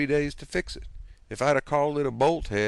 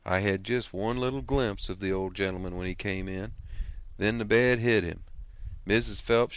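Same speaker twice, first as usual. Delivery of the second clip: usual speaking voice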